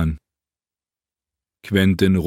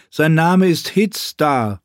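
much higher, slow, Hitzdar stressed; pointed correction; 9 semitones higher, 3 dB louder, slower than usual. Acted. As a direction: spell out the right name slowly. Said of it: "verbesserte ihn Quentin". (langsam, Hitzdar nachdrücklich betont)

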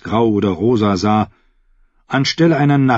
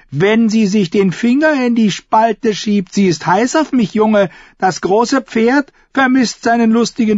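high, loud, sneering jester voice; mocking mad fool; 14 semitones higher, 4 dB louder than usual. A narrator's reading. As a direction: taunt the crippled smuggler gleefully. Shackwell boasts lewdly. (höhnischer Narr, hoch und laut)

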